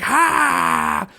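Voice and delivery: high-pitched "ha" yell; high heroic yelp; mock battle cry. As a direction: act out a hero's defending shout. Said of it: "He's just like".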